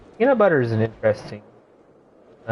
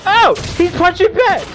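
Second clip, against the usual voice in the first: excited shout; 5 dB louder than usual. Real, not acted. Hyped by fight on screen.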